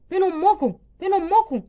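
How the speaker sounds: angry father shouting. Acted. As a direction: call the mother and scold the girls loudly. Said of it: "بتزعق كده ليه". (زعيق أب غاضب)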